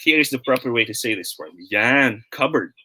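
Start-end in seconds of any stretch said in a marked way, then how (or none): 2.3-2.7 cupboard said as KUB-erd, the P left silent; teacher models cupboard with no P sound. Shown as a demonstration. Teacher showing students the right way: silent P. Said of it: "We don't pronounce the P".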